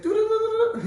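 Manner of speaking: high, loud wailing siren sound; siren imitation. Shown as a demonstration. Teacher imitating the shofar as siren, high loud wail. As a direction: sing out like an alarm. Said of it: "an emergency siren".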